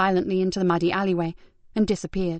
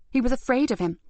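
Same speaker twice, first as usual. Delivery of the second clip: ordinary delivery, nothing marked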